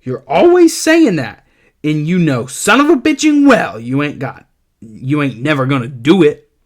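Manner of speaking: high, loud cursing tirade; aunt going off on Lenny; angry Aunt Clara. Acted. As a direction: chew him out. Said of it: "Aunt Clara says".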